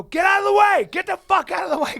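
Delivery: high, urgent full shout; photographers shouting. Retold or imitated. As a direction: clear someone off the red carpet. Retold red carpet staff shouting.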